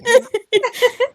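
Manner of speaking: high, loud character laugh. Acted. Cecil laughing at his own joke, high loud laugh.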